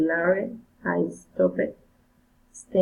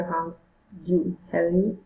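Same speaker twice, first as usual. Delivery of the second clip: ordinary delivery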